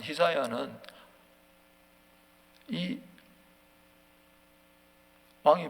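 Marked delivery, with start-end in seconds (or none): none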